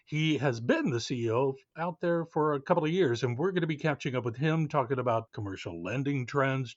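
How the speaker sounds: bright, clear announcer read; upbeat show opener; podcast host intro. Acted. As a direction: welcome the listeners like a radio host.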